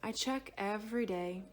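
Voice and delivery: usual speaking voice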